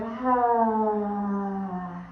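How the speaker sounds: long audible sigh out through an open mouth; open-mouth exhale. Shown as a demonstration. Yoga teacher showing students how to truly arrive, open-mouth exhale. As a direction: let the breath go in a falling sigh. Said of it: "Open mouth exhales".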